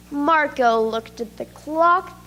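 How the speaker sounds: ordinary delivery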